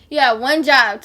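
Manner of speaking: "job" hit hard and loud on the repeat. Retold or imitated.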